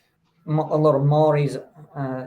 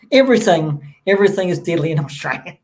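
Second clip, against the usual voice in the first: louder, higher, punching everything; emphatic exaggeration; 3 semitones higher, 6 dB louder, faster than usual. Real, not acted. Telling a friend everything in Australia kills, loud and emphatic. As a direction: sell it as a comic overstatement.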